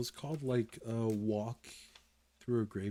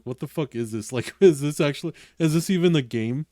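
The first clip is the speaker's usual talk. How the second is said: louder, incredulous mock outcry; baffled outburst; 4 semitones higher, 11 dB louder than usual. Players reacting to the strange game, loud and incredulous. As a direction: play the confused crowd, loud.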